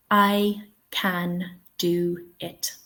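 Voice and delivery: slow, separated words with a full vowel in "can"; over-careful word-by-word "I can do it"; every word stressed. Shown as a demonstration. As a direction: give each word of "I can do it" equal weight, slowly. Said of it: "I would never say it like this".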